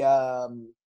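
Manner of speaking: usual speaking voice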